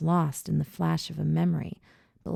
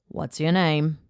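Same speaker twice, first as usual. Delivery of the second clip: put-on man's question in an Australian accent; 3 dB louder than usual. A narrator's reading. Rescuer asking an injured boy's name, Australian accent. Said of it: "in an Australian accent".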